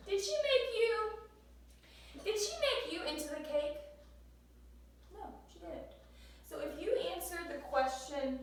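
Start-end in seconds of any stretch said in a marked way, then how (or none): 0.1-3.8 high, loud incredulous question; mock disbelief. Shown as a demonstration. Teacher mocking a wrong answer to the class, high mock surprise. 5.1-5.9 whispered no, higher pitched; whispered aside. Shown as a demonstration. Teacher answering her own joke question, in a whisper.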